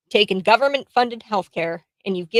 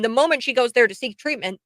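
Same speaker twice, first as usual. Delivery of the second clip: ordinary delivery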